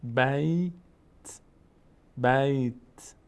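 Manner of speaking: بیت slowly stretched, ending in a bare t; drawn-out "bayyy-t" for بیت; practising stopped t. Shown as a demonstration. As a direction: hold the "ay" in بیت, then a separate light t.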